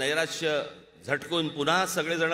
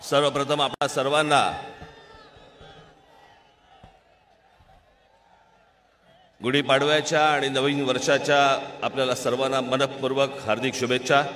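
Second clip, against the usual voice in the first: rally speech. Real, not acted. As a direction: open the rally with full voice. Opening address to rally crowd.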